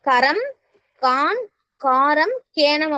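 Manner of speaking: drawn out, very slow listing; slow demo. Shown as a demonstration. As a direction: spell each term slowly for the class. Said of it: "தமிழில் எழுத்துக்களை குறிப்பிடுவதற்கு". (மெதுவாக நீட்டி சொல்லுதல்)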